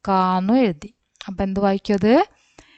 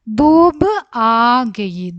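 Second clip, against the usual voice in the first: slow emphatic reading; 2 semitones higher, 4 dB louder, much slower than usual. Shown as a demonstration. Teacher reading the poem title aloud to class. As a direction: announce the title slowly and clearly. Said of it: "teacher reads the poem title".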